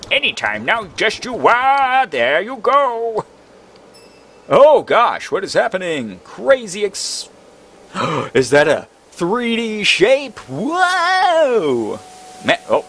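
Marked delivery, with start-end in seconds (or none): 0.1-3.2 much higher, slow and sing-song; high slow character voice. Acted. Voicing a game character. 10.5-12.0 long high astonished whoa; be blown away by the sight; amazed whoa. Real, not acted. Amazed at the 3D world.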